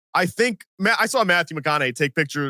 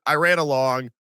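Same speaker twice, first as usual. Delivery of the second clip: usual speaking voice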